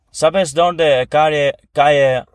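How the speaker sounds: mock English tourist asking directions; English speaker's Spanish. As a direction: stumble over "calle" like a tourist. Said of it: "como si un inglés te dice".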